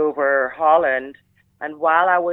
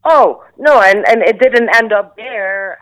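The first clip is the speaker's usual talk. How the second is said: excited protest; 5 semitones higher, 10 dB louder than usual. Real, not acted. Laughing protest at host's question.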